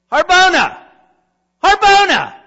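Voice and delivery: loud, high call across the palace; king shouting for his servant in the night. Acted. King calling his servant, loud and high.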